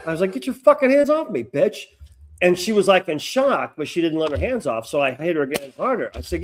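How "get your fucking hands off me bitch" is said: higher, louder mock-angry outburst; retold angry demand. Student to the woman grabbing him, retold angry demand. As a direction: re-enact ordering her off, raised voice.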